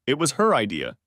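usual speaking voice

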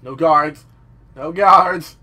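loud repeated call-out; loud exclamation. Real, not acted. Exclaims on finding no guards.

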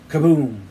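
loud imitated blast; booming falling kaboom; storyteller's sudden loud boom; bomb explosion. A narrator's reading.

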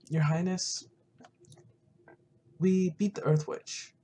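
ordinary talk

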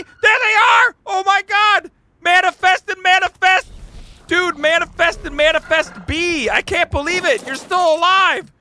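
reunited with his abandoned creatures, overjoyed; excited squeal. Real, not acted. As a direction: gush over the pets you found. Finds his left-behind pets.

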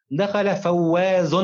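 "فواز" drawn out slowly to its final un sound; stress on tanween. Shown as a demonstration. Teacher reads for pupil to find the tanween, "فواز" slowly stressed. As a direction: stretch "فواز" and sound the -un ending clearly. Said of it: "بها تنوين بالضم".

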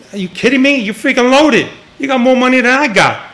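high-pitched, indignant disbelief; incredulous protest. Retold or imitated. Teller replays refusing a loaded boss's loan request, incredulous.

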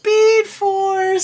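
high, drawn-out dramatic call; dramatic announcement. Retold or imitated. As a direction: call it out like an epic reveal. Dramatic announcement in episode retelling.

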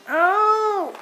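high sharp cry of pain; high pained yelp. Real, not acted. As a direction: yelp as it pinches. Clothespin pinches face.

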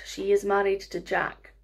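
ordinary delivery

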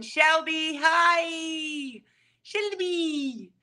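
high, drawn-out hello; high-pitched happy call; excited greeting. Real, not acted. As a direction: sing out the greeting high, letting it fall away. Calling out greeting to viewer.

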